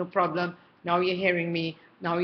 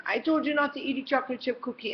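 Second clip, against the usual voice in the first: higher, fast exasperated parent telling off; fast, raised-pitch scolding of a child; mock angry scolding; 7 semitones higher, faster than usual. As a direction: snap at the kid who ate the cookie. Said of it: "you get angry with them".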